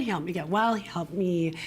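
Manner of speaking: ordinary delivery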